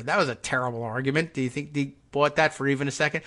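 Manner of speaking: normal talking voice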